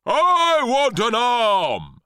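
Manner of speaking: high, loud, stretched-out roar; shouting. A narrator's reading. Cabbage pounces on the boy, shouting. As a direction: bellow greedily, dragging out the words. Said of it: "shouted the cabbage".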